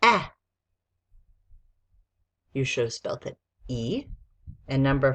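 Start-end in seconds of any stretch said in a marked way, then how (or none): 0.0-0.3 short "eh" on its own; isolated vowel sound. Shown as a demonstration. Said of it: "Number four is".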